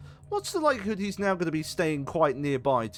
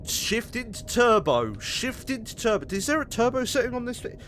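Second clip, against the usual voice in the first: frantic; 10 semitones higher, 4 dB louder than usual. Real, not acted. Fleeing, frantic.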